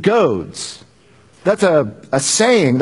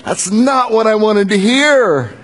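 dismayed gasp; 5 semitones higher, much slower than usual. Acted. Saul's dismayed reaction.

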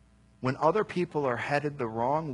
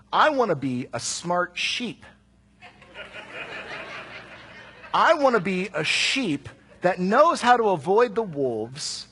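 emphatic; 6 semitones higher, 6 dB louder than usual. Real, not acted. Preacher confessing his stubborn wish.

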